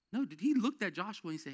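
loud, high, driving sermon voice; preaching at full force. Acted. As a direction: preach it hard and push the congregation.

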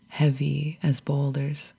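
plain reading voice

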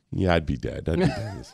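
low, soft, understated aside; lightly acted soft admission; mock resignation. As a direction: drop it low and quiet, as if conceding. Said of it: "I was like".

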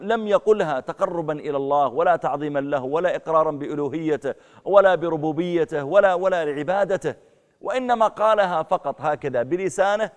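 preacher denouncing a false convert, loud and high; fiery preaching. Acted. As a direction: drive the point home to the crowd. Preaching at full force.

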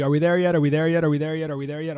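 put-on whiny kid nagging from the back seat, fading; pestering child. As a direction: repeat it like an impatient kid. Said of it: "every kid has, uh, done the".

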